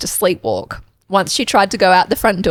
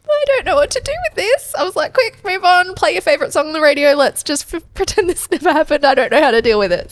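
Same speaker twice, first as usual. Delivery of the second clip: mock panic; 9 semitones higher than usual. Her panicked reaction retold.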